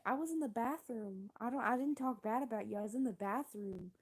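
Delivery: confused protest. Retold or imitated. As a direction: protest as if wrongly accused, confused. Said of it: "I was confused".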